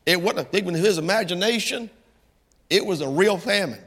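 ordinary talk

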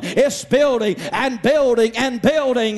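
high, fervent sermon voice, slowing into it; preaching at full force. Acted. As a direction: preach it up, building. Preacher building up.

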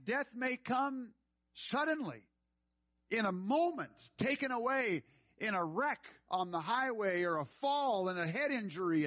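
preacher pleading with the congregation about eternity, fervent; fervent preaching. Real, not acted. Preacher urges salvation.

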